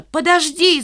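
высокий протяжный окрик; окликни убегающего; крик вдогонку. A narrator's reading. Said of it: "закричала я". (кричит вслед)

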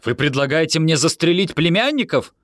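high-pitched incredulous question; crying out, baffled. A narrator's reading. Yoni misunderstands Leras's gun hint, crying out baffled. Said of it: "вскричал я, не понимая".

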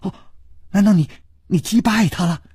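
rapid, amazed princess voice; cutting in eagerly. Acted. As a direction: jump in with a stammer of disbelief. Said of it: "琵琶公主已经抢着说".

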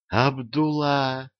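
slow, startled gasp of a name; gasping in surprise. A narrator's reading. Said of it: "ахнул Кефер".

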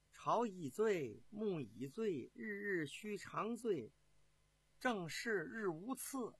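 slow, drawn-out poem recitation; measured verse with lingering words; reciting verse. A narrator's reading. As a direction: intone the lines slowly, stretching each phrase. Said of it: "给皇帝啊做了一首诗".

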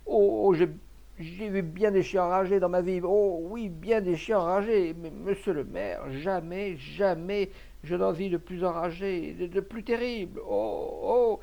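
high, loud, stammering alarm; panicked exclaiming. A narrator's reading. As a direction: wail as if still shaken by the attack. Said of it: "s'écriait monsieur Bernard en se tâtant".

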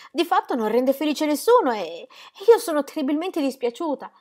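voce femminile più acuta e agitata; Ingeborg implorante. Acted. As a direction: moglie che supplica con ansia. Ingeborg pleads. (voce alta e implorante di Ingeborg)

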